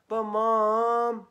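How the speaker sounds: high, loud drawn-out whine; whiny plea. Acted. Ryan whines at Mom, drawn out. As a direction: stretch the whine out. Child pleading.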